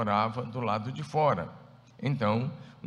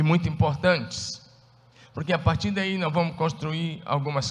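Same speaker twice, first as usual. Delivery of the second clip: loud, fervent sermon voice; preaching; 4 semitones higher, 5 dB louder than usual. Acted. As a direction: preach it out with fire. Preaching at full force.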